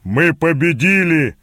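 drawn-out victory exclamation; slow, exultant proclamation; triumphant cry. A narrator's reading. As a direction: announce the win slowly, in triumph. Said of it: "торжествующе выкрикнул Гэс".

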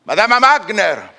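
loud, high call across the house. Acted. Faust calls his servant Wagner, loud call. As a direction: summon her from another room.

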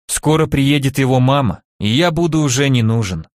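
usual speaking voice

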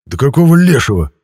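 high, loud indignant shout; indignant outburst. A narrator's reading. The hero shouts in outrage at the ghost. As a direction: burst out in outrage. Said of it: "возмутился я".

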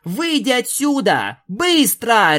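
high, loud bark of a command; barked order. Acted. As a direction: snap the order at him, full force. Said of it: "рявкнула Рейнбоу". (громко рявкнув)

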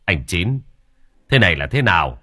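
usual speaking voice

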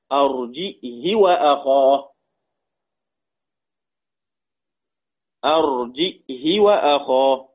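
"أرجئه وأخاه" recited slowly with glottal stop, short "hi"; hamza, short kasra. Shown as a demonstration. Teacher showing Ibn Dhakwan's reading, "أرجئه وأخاه" as arji'hi, slow. كسر الهاء.

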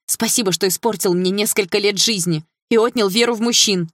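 sarcastic thanks, seething; boiling anger. A narrator's reading. Dilara reproaching Mel on the phone, seething. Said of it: "всё больше закипала".

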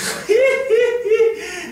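put-on a short, high laugh at the young officer; amused laugh. Senior officer laughing off the lieutenant's worry, amused laugh. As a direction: scoff with a laugh at his naive answer.

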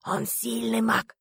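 drawn-out, very slow repeat; concluding restatement. A narrator's reading. Корнеев finishing his speech to the hidden team, slow and drawn out. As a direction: restate it slowly, stretching every word. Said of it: "завершил свою речь Корнеев".

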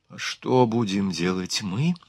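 soft, slow hushed question; mild stage whisper, drawn out. A narrator's reading. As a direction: ask it under your breath, slowly. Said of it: "прошептала Луиза Фюзиль".

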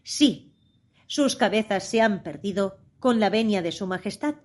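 ordinary delivery

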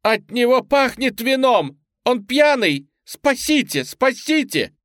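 high, loud screaming for help. A narrator's reading. Woman in the narrator's grip screaming for help, high loud wail. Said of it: "вдруг завопила во весь голос".

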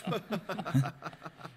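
soft pained laugh. Acted. Tortured prisoner laughs softly in front of his interrogators. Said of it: "Gelächter in der Sitzung".

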